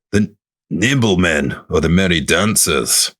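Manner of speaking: put-on Scottish accent. Reading out Scotland's names for the wisps, put-on Scottish accent. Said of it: "I'm doing all the accents".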